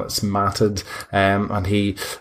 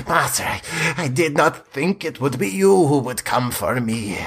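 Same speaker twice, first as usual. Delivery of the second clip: high, pleading and wretched; a starving vampire begs his master for release; starved pleading vampire; 5 semitones higher than usual. Acted. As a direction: beg like a starving creature locked away. Cowering vampire spawn speaks.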